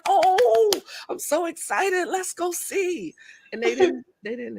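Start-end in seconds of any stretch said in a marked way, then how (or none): 0.1-3.1 high, slow, gushing excitement; excited mom. Retold or imitated. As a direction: gush like it's a treat. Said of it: "I would be like".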